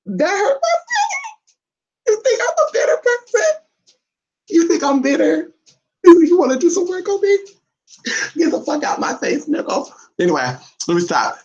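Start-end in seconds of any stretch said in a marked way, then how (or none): 0.1-3.5 much higher put-on wounded voice, laughing through it; mock hurt. Owner pretending the dog hurt her feelings, high mock-hurt voice. 4.5-5.5 much higher put-on voice, rising question; mock offended. Owner asking her dog if she's bitter, high mock-offended voice. 6.0-9.9 mock telling off. Owner playfully shooing her dog away, high sassy put-on voice.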